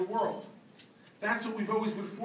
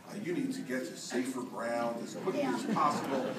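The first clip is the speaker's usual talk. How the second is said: stiff official tone; 7 semitones lower, 5 dB quieter than usual. Retold or imitated. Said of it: "tried to act very gubernatorial".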